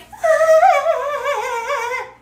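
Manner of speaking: long, loud, high-pitched fake giggle; mock a gamer girl's squealing laugh; shrill put-on titter, held long; girly giggle. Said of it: "And then she's like".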